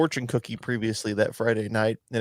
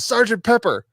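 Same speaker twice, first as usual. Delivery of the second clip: mock protester; 11 semitones higher, 4 dB louder than usual. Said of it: "sprays him and says".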